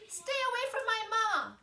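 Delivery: high, loud little gosling cry; a fierce baby goose protecting mom; young gosling shouting. A narrator's reading. Gosling defends his mother.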